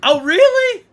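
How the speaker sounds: high, loud exaggerated oh really; hammed-up surprise; mock surprise.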